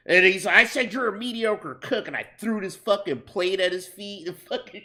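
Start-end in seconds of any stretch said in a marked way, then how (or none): 0.6-2.1 higher-pitched mock boss; boss doubling down. Boss repeating his insult to the cook, insistent retort.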